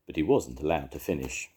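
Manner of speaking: plain reading voice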